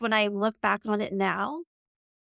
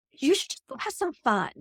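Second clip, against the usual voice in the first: lightly voiced parents, higher pitch; higher-pitched mock parental advice; imitating parents; 7 semitones higher than usual. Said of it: "my parents were like".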